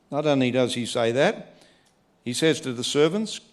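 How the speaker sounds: usual speaking voice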